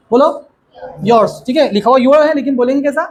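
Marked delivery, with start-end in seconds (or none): 1.1-1.3 "yours" said as "yors"; one syllable for "yours", rhyming with "doors"; spoken pronunciation. Shown as a demonstration. Teacher has pupils repeat, "yours" as one-syllable "yors". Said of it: "लेकिन बोलने में कैसा आता है".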